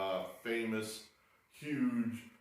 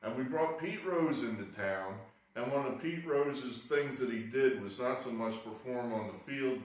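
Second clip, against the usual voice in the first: ordinary talk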